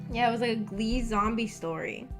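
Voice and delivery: usual speaking voice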